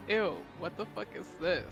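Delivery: usual speaking voice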